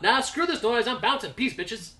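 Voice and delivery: high, loud and cocky; brash high exit line, tossed off; high cocky Aegon. Retold or imitated. Aegon voiced leaving Valyria.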